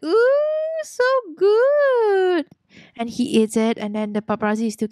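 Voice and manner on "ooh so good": delighted. Acted. [name] savouring ice cream.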